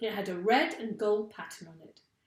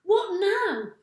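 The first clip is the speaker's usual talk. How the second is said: eager question; 12 semitones higher, 9 dB louder than usual. A narrator's reading. Animals asking together.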